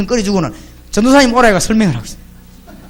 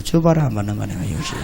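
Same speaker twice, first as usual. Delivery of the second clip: inner thought; 13 semitones lower, 9 dB quieter than usual. Retold or imitated. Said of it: "내 속으로".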